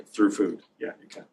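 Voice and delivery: normal talking voice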